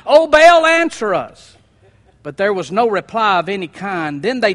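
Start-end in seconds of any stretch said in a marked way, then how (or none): 0.1-1.6 loud, high cry to an idol; frantic shout. Acted. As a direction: call out to Baal at full voice.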